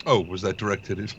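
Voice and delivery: put-on Russian accent slipping into British; a Russian voice that drifts into British; accidental British accent. Player's Russian accent drifts British. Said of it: "That was British".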